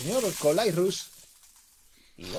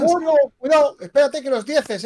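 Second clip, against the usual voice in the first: loud, high cautioning call; keyed-up, loud heads-up; excited warning; 8 semitones higher, 8 dB louder than usual. Real, not acted. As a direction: warn urgently with a raised voice. Co-commentator reacts to the all-in.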